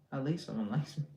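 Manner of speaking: ordinary talk, nothing marked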